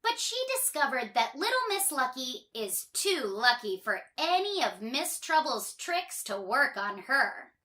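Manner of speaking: plain reading voice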